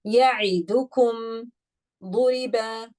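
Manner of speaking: Ya'idukum and duriba with short, even vowels. Shown as a demonstration. Teacher recites to class with short vowels. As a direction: keep each vowel short. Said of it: "I'm not gonna make the sound long".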